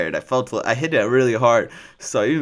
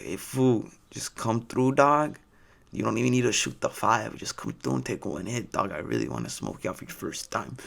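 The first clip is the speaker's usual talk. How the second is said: [name]; 6 dB quieter than usual. Retold or imitated. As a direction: imitate a stoner homie's accent, talking him into it. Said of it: "I'm not just doing this accent".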